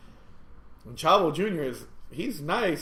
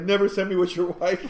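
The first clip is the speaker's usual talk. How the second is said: raised, loud comic protest; mock complaint; 6 semitones higher, 8 dB louder than usual. Passenger complaining to the driver's husband, mock outrage. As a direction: plead loudly, only joking.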